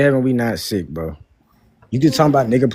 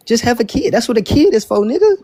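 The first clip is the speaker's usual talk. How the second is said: higher and higher, worked up; exasperated yelling; 9 semitones higher than usual. Real, not acted. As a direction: fire it back, exasperated. Heated argument, rising exasperation.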